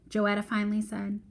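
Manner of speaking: ordinary delivery, nothing marked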